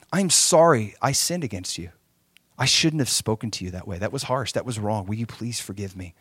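low, hushed plea for forgiveness; acted soft apology. Husband apologizing to his wife for harsh words, acted gently.

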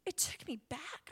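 ordinary talk

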